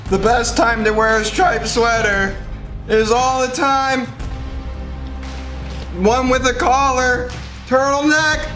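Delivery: reciting through a burning mouth; strained, slow, pained recital; mouth burning from spicy food. Real, not acted. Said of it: "recite SpongeBob's striped sweater song".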